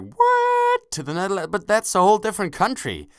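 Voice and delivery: squeaky high startled What, then a fast protest; stunned, protesting a move abroad; shocked disbelief. Retold or imitated. Boy stunned his mother wants to move, squeaking in disbelief. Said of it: "basically I was like".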